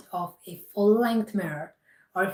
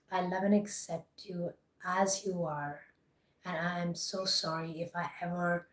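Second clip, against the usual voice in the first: guided meditation; 5 dB quieter than usual. Acted. Guided self-love affirmations.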